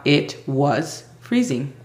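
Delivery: slow, drawn-out English demo; slow model answer. Shown as a demonstration. Teacher showing the shorter answer, slow and clear. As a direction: stretch it out for learners. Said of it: "O podríamos también cambiarlo a".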